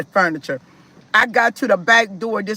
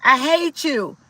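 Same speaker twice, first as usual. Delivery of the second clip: lightly voiced child chanting "I hate you"; a child repeating it, a bit higher; son's I hate you; 6 semitones higher than usual. The oldest son telling his mother he hates her, a lightly voiced child. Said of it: "my oldest son said".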